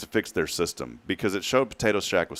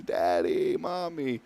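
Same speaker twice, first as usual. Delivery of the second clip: put-on high, soft little-kid call on the end; small child's sing-out; little daughter calling; 5 semitones higher, 4 dB quieter, much slower than usual. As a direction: imitate a sleepy toddler calling parents. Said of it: "she comes walking into the office".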